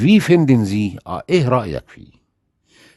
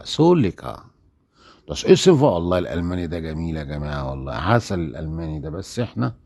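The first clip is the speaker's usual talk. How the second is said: normal talking voice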